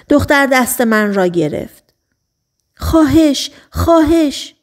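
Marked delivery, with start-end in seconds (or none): 2.8-3.5 high, pleading please; begging girl. Acted. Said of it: "دختر ملتمسانه جیغ زد". 3.7-4.5 higher, even more desperate please; begging girl, more urgent. Acted. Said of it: "دختر ملتمسانه جیغ زد".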